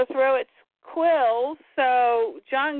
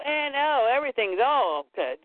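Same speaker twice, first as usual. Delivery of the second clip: normal talking voice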